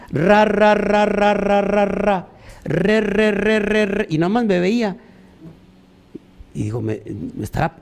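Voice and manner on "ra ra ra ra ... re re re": mock prophet gibberish. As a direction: parrot the prophet's empty syllables. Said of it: "qué se pasó diciendo el profeta".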